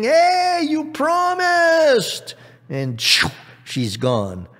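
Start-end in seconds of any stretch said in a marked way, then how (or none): 0.0-2.3 very high, loud frog wail; high, slow, outraged holler; indignant calling out. Acted. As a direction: yell it after someone running off, drawn out. Frog calls after fleeing princess.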